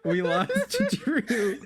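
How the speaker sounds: high, slow laugh-talk; words broken by giggles. Real, not acted. Giggle fit in the group.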